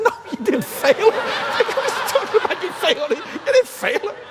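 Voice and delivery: high, laughing scolding of a friend; laughing mock scold. Pastor laughing down a bishop's worry.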